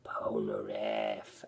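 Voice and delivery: Polnareff whispered, drawn out and low; slow whisper. Retold or imitated. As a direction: breathe the name slowly, almost no voice. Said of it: "And then she's like".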